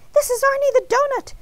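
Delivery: high-pitched, urgent introduction; frantic. A narrator's reading. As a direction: plead to be recognized, pitch way up. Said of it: "Arnie frantically whispered".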